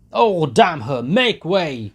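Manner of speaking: loud, high curse; furious yell. A narrator's reading. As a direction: snarl and shove through. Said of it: "Mikolka yelled furiously".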